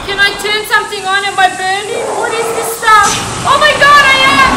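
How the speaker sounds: high, urgent voice climbing to a scream; rising panic. Real, not acted. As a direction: build from alarm to a loud shriek. Discovers they are burning in game.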